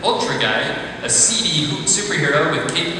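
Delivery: ordinary delivery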